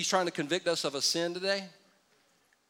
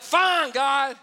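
exasperated surrender; 8 semitones higher, 5 dB louder than usual. Retold or imitated. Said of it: "you're like".

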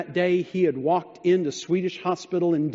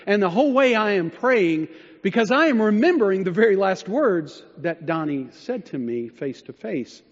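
usual speaking voice

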